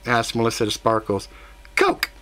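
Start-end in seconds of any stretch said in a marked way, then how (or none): 1.8-2.1 squeaky eager shout. Acted. Baby ordering a drink.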